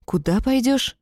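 taken aback, pitch lifted; surprised query. A narrator's reading. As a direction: ask again in surprise. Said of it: "Удивлённо смотрю на него и переспрашиваю".

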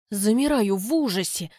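usual speaking voice

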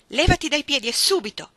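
loud, high, furious command; angry order. Acted. Iggy to Ruth through the door, angry order. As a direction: drive her off from behind the door.

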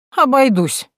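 high, huffy dismissive snort; scornful snort. Acted. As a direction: toss it off with a sniff. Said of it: "фыркнула Минадора". (фыркнув, пренебрежительно)

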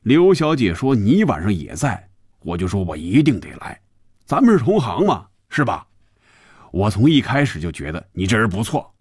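jovial, animated pitch swings; hearty banter, smile in the voice. A narrator's reading. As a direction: play a chummy, smiling deputy boss. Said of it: "周副总笑着说".

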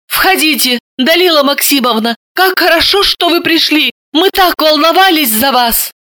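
high, bright-eyed greeting of relief; joyful welcome. A narrator's reading. Hostess happily greeting an awaited guest, high and joyful. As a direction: welcome her in with glad relief. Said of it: "радостно запричитала".

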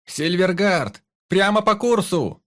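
high, loud shout from the crow's nest; lookout's call. A narrator's reading. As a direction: call it out to the whole ship. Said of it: "подал голос матрос в гнезде".

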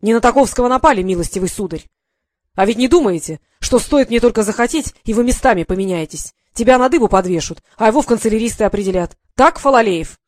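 loud furious dressing-down of the clerk; the general berating the clerk; angry scolding. Acted.

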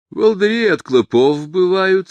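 high, emphatic lecturing tone; didactic. A narrator's reading. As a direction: set them straight like a lecturer. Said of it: "сказал голем наставительно".

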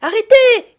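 very high, very loud cry to stop; girl shouts to halt her rushing friends. A narrator's reading. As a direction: stop them with a sharp cry. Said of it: "cria Camille".

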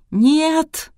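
high, drawn-out cry of denial; wailing scream. A narrator's reading. Kristina refuses the diagnosis, wailing. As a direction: wail it out in panic. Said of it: "снова завопила Кристина".

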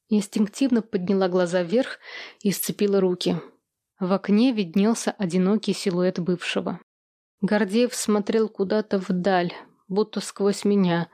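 plain reading voice

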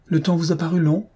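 a touch of worry in the asking; worried question. A narrator's reading. Madame d'Étiole asks whether time dragged, mildly worried. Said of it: "inquiète".

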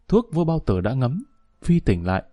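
ordinary delivery, nothing marked

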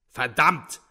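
loud, angry outburst; roared curse. A narrator's reading. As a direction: bellow the curse. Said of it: "brüllte Trudoljubow".

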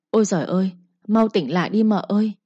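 usual speaking voice

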